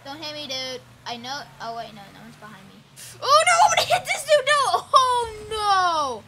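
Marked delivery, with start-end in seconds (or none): 3.2-6.2 fast, much higher, louder cry of no through laughter; panicked laughter as the crash comes; laughing scream of oh no; laughing panic shout. Real, not acted. About to crash in race.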